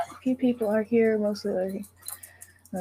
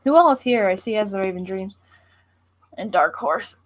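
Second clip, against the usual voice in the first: usual speaking voice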